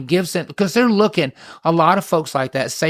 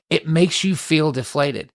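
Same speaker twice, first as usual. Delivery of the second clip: ordinary delivery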